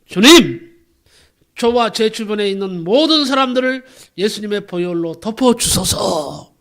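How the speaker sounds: loud, imploring pastor's prayer; fervent retold prayer. Pastor prays over everyone around him, fervent loud prayer. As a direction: cry out to the Lord, drawing out the last plea. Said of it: "기도하기를".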